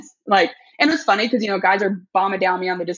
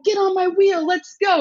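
high-pitched call to riders behind; rally the group, pitched way up; re-enacted race holler, high; retold rallying call; 12 semitones higher than usual. Calling out to riders behind her.

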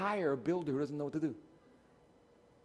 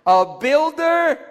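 a rising shout to the whole church; loud drawn-out call; 19 dB louder, much slower than usual. Real, not acted. Pastor urging everybody to answer the last one, loud and drawn out. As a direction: call "builder" high and loud, slowly, to rouse the room.

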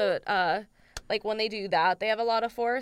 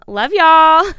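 much louder, sing-song farewell; loud sign-off; 6 semitones higher, 16 dB louder than usual. Real, not acted. Host signing off to listeners, loud and bright. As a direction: belt it out to the audience.

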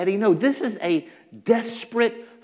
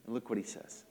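normal talking voice

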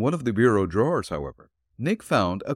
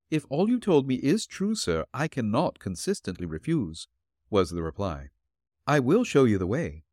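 put-on elderly landlady voice; 2 semitones higher than usual. Landlady answering the detective at her door, politely.

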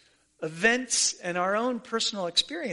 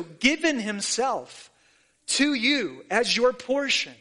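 ordinary delivery

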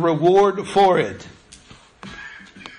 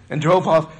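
ordinary talk

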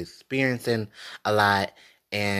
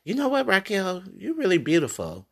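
higher, open imagined man's compliment; sincere compliment; 9 semitones higher than usual. Retold or imitated. Wishing a man would just call her beautiful, voiced higher. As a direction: say it like you fully mean it. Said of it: "why is it so hard for you to just be like".

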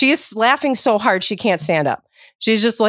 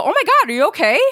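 high-pitched startled check-in; alarmed concern; 8 semitones higher, faster than usual. Shown as a demonstration. Bystander to someone who fell down, alarmed concern. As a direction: react as if someone just fell. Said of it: "Someone falls down, you go".

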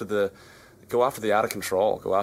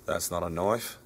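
Australian accent; 3 semitones lower, 5 dB quieter than usual. Shown as a demonstration. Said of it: "say in your best Australian accent".

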